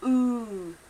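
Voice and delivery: "ooh" drawn out long and rounded. Shown as a demonstration.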